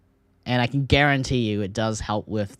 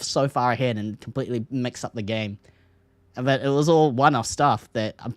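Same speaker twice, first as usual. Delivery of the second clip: ordinary talk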